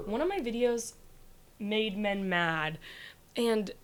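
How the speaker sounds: ordinary talk, nothing marked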